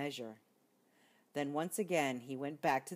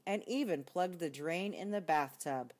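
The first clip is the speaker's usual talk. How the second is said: ordinary delivery, nothing marked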